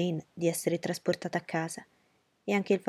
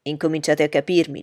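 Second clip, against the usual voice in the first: exclaimed; 9 dB louder than usual. Acted. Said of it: "esclamò Bingley".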